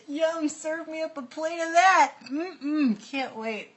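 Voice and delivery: high-pitched sarcastic relish; mock eagerness. Joking to co-host about printed food, mock eager. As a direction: gush with fake appetite, close to laughing.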